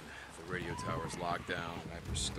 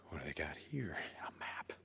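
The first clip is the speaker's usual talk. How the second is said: muttered whisper; 3 semitones lower, 11 dB quieter than usual. Acted. Muttering alone in bunker.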